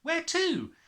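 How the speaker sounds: put-on high, startled girl's cry; shocked cry. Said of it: "Matilda cried".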